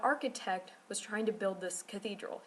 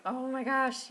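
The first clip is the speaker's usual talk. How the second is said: retold "Oh my gosh" pitched higher and louder; awed gasp; 6 semitones higher, 6 dB louder than usual. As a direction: awestruck exclaim as if seeing the window again. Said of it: "and I'm just like".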